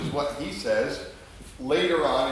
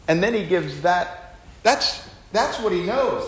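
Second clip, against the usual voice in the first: usual speaking voice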